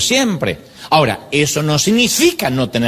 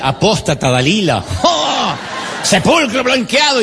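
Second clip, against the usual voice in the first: hammed-up outraged shout; mock shouted insults; 6 semitones higher than usual. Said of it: "Y él, y él". (esposo gritando insultos cristianos en broma)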